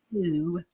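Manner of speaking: "no" with a flat Australian vowel; attempted Australian "no"; Aussie flat no. Shown as a demonstration. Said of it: "It's that flat no".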